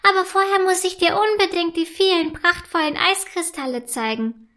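keen to show off crystals; lightly high elf; eager ice elf. A narrator's reading.

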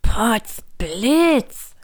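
verblüfft ausgerufen; staunend. A narrator's reading. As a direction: voller Staunen aufrufen. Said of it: "staunte Hugo". (der Hase staunt über den Zauberstab)

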